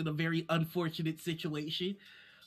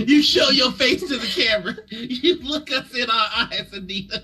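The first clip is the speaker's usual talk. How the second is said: loud laughing demand; 5 semitones higher, 11 dB louder than usual. Real, not acted. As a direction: call it out laughing.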